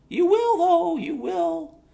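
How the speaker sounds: sudden high, loud warning cry; a high loud ominous warning. Real, not acted.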